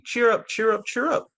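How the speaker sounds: high, bright chirping cheer up; robin's call. Acted. Robin greeting the spring, chirpy high call. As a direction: sing it like a robin calling.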